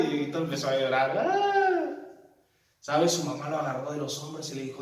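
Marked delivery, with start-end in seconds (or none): 1.2-2.0 a high hammed-up wail; mock child wail. Dieguito llorando de miedo, un grito agudo fingido. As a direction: fake a kid bursting into tears.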